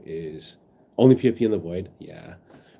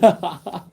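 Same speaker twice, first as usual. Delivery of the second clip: giggle; 9 semitones higher, 4 dB louder than usual. Real, not acted. Laugh after a joke.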